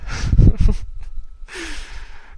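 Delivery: high, loud breathy laugh; real burst of laughter. Real, not acted. Reader laughing at the weird idea of dragon breeding ponies. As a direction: crack up at your own joke.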